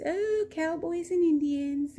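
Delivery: drawn out, a touch of delight; amused helper lady. Retold or imitated. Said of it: "And she goes".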